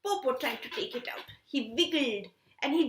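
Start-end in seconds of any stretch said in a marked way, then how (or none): none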